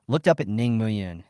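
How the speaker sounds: plain reading voice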